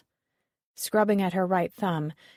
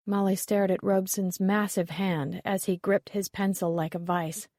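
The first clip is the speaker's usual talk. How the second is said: ordinary delivery, nothing marked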